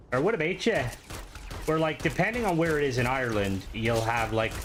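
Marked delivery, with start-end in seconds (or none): 0.2-0.8 put-on higher-pitched Northern Irish accent, lightly done. Showing a friend how Northern Irish people sound, in a higher Northern Irish accent. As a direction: give a quick Northern Irish greeting. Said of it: "Northern Irish".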